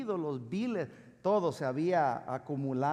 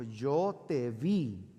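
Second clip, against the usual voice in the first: drawn-out, lower voice from nowhere; mysterious voice; 5 semitones lower, much slower than usual. Acted. Unseen voice catching the lazy cleaner out, slow and mysterious. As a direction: stretch each word slowly, like an unseen watcher. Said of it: "escuchó una voz que le dijo".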